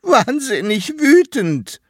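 high, loud tearful wail; sobbing. A narrator's reading. Hedgehog insisting to the toad that he is angry, sobbing. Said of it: "schluchzte der Igel".

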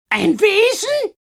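very high, slow, loud shriek. Acted. Misses Crabone shrieking at Katrina in scorn. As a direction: scream the word back in scornful disbelief. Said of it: "schrie sie".

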